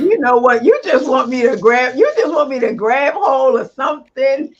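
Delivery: loud, high, playful outburst; mock exasperation. Real, not acted. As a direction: protest loudly at being goaded, half joking. Playful protest at provocative question.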